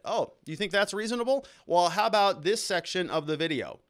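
imitating a lawyer grinding the point home; twisting the knife. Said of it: "he just twists it right in his back".

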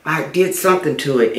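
usual speaking voice

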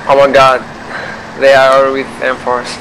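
usual speaking voice

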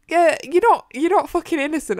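high, loud accusing tone; mock scolding. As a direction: tell off a fictional character indignantly. Mock scolding of character Matthew.

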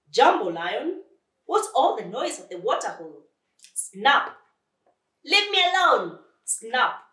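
loud, high and irritable; snappish crocodile. Acted. Crocodile at the water hole, snapping at lion. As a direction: bellow to be left alone.

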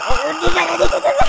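high squealing panic laugh; laughing and shrieking while fleeing; shrieking nervous laugh. Real, not acted.

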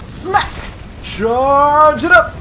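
high, loud, stretched attack calls; battle cry. Real, not acted. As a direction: shout as you wind up and strike. Calling out the charged attack.

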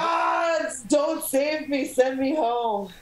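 high cry slowing to drawn-out plea; dramatic plea. Acted. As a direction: wail it to the heavens, then slow down. Mock plea to the gods.

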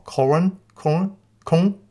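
koan tried as "kor-un", then "kong", rising; unsure mispronunciation. Real, not acted. Teacher unsure of a Zen term, koan mispronounced questioningly. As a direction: grope for "koan", questioning the vowels. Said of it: "I always say it wrong".

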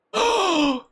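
sharp high gasp of shock; dramatic gasp. Real, not acted. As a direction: gasp as if the twist just hit. Sudden reveal of the culprit.

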